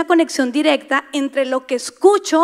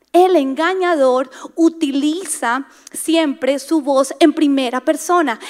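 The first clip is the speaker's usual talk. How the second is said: ordinary talk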